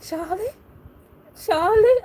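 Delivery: rising, suddenly loud calling of Charlie; Claudia calling Charlie. Retold or imitated. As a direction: call him desperately as the girl.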